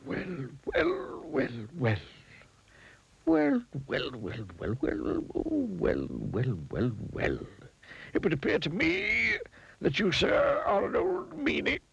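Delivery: high, swooping wizard voice; disapproving wizard. Acted. Wizard finding the miser's spilled money, disapproving. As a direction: draw out the tut-tutting in a high voice.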